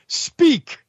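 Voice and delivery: high, sudden one-word order; curt phone command. Retold or imitated. Host answering a spam call, curt command. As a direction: pitch it up like ordering a caller to talk. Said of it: "hit the button and go".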